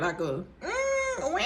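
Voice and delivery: high, drawn-out, thin little newborn wail; faint mewling newborn cry; imitated newborn's cry. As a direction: mimic a tiny baby's first cry, slow and high. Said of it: "Is it a cat in here?".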